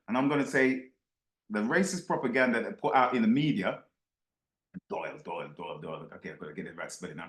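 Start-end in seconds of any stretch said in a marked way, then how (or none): 4.9-7.3 low, much softer muttering while typing a name; muttering to himself. Real, not acted. Host mutters to himself while typing the name, low and soft. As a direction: mumble it under your breath as you type.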